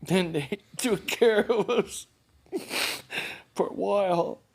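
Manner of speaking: high, choked up, long halting pauses; real tearful voice. Real, not acted. Man remembering sheltering with his brother, crying. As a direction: push the words out through sobs. Keeps telling through tears.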